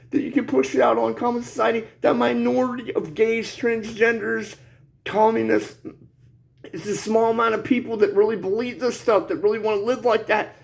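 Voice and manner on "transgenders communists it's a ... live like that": normal talking voice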